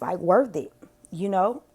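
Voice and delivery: hoarse voice cracking before a throat clear; voice giving out. Real, not acted. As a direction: voice fading, strained and hoarse. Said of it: "my voice keep going out".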